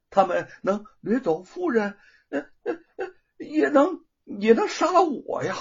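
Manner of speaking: high, frightened stammer; panicked stammer. Acted. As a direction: panic rising. Panicked magistrate.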